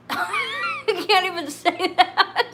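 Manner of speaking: high, slowed words choked with laughing; barely able to speak for laughing; real helpless laughter. Real, not acted. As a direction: wheeze it out mid laughing fit. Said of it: "Now I'm laughing".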